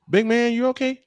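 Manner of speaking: lightly imitating a teammate checking in; teammate's call. Retelling how teammate Victor called to him, mildly mock concerned. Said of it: "Victor kept call".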